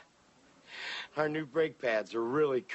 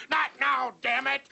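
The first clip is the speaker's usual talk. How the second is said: higher, testy dad snapping back; irritated snap; 8 semitones higher, 4 dB louder than usual. Acted. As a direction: snap at the kids. Dad snaps at kids.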